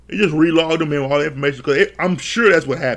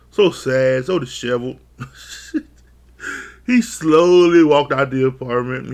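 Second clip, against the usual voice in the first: ordinary delivery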